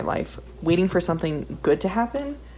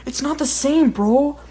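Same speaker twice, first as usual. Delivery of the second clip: frustrated protest; 8 semitones higher, 7 dB louder than usual. Retold or imitated. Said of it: "And I'm like".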